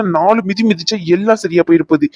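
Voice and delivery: usual speaking voice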